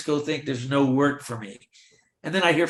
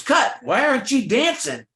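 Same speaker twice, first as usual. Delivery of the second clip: high, loud shouted cut; director yelling; 11 semitones higher, 5 dB louder than usual. Retold or imitated. Director on set yelling at the extra who won't dance. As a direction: snap at the extra like an angry director.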